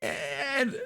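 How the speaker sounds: put-on high, polite little girl greeting strangers. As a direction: pitch up into a small girl's voice. Girl tells of the monster.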